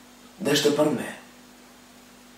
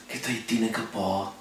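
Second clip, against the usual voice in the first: normal talking voice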